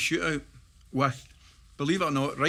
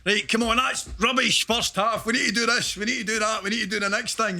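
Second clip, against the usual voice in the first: higher, urgent mock team talk; footballers' dressing-room rant; 8 semitones higher, 4 dB louder than usual. Players overacting for the film at half-time, mock urgent rant. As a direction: imitate team-mates rallying each other at half-time. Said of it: "we're all saying".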